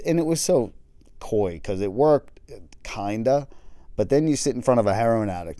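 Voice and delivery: ordinary delivery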